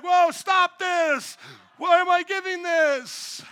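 high, slow shouted protest; puppet Pinocchio. Pretend. Pinocchio puppet protesting its hand going to the wallet, high slow shout. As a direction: yell like a puppet yanked by strings. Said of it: "I'm like Pinocchio".

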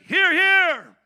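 loud, high hearty cheer; British Parliament heckler. Retold or imitated. Members of Parliament backing a speaker, loud imitated call. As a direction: bellow approval like a backbencher. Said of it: "have you ever watched Parliament in England".

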